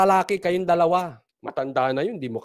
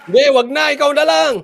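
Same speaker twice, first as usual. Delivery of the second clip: high, loud raised-voice comeback; mock loud retort; 7 semitones higher, 8 dB louder than usual. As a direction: shout it back mockingly, pitch raised. (pasigaw na pakutyang sagot)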